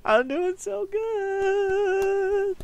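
high, slow, whimpering fake cry; mock sobbing. Gamer jokingly crying about dying again, fake sobbing. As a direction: ham up the tears over your score.

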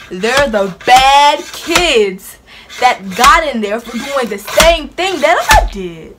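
loud, slow, indignant emphasis; loud slow disbelief; heated. Retold or imitated. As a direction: spell it out, outraged. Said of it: "I was so heated".

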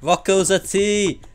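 loud slow accusation; shouting. Real, not acted. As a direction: call him out loudly. Accusing traitor urgently.

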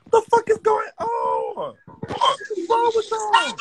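high, slow, stretched disbelieving cry; shocked yell. Real, not acted. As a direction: drag it out in pure disbelief. Uncle shocked at live stream.

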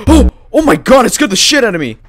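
loud, high startled outburst; real fright. Real, not acted. Gamer scared by a jump scare, loud fright. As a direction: cry out as if just jumped.

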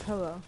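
"pillow" with an eh, as PEL-oh; pellow pronunciation. Shown as a demonstration. Claiming the right way to friends, "pillow" as PEL-oh. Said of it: "I'm calling it by the right way".

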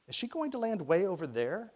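put-on high-pitched, eager child's question; excited boy's question. A narrator's reading. Ty asking Rennie where she will land, excited. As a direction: ask it high and eager, like a little boy.

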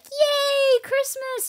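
put-on very high child's cheer; high mock child cheer; kid excitement. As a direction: squeal like a kid on Christmas. Kids excited.

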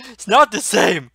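exasperated shout; angry protest. Real, not acted. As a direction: yell it back as an indignant retort. Angry retort to girlfriend's suggestion.

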